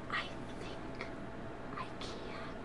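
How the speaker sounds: breathy whisper of effort. Acted. Grandma voicing the little engine, whispered. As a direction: whisper it like a tiny engine straining.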